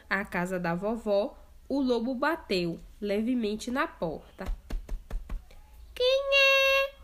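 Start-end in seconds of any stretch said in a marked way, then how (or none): 5.9-6.9 put-on much higher, louder old lady; grandma's voice. Grandma asking who knocks, high voice. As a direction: call out from bed. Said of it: "perguntou a vovozinha".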